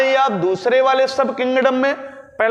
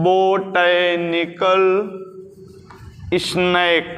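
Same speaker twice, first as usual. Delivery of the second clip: low, syllable by syllable; slow dictation; 4 semitones lower, much slower than usual. Shown as a demonstration. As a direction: draw out each word with a pause between. Repeats English term slowly. (धीमी, नीची आवाज़ में खींच कर लिखवाते हुए)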